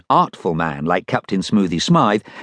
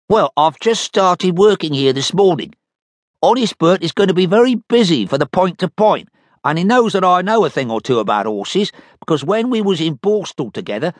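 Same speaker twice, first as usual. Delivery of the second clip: Dud Check's voice; 9 semitones higher than usual. Acted. Dud explains.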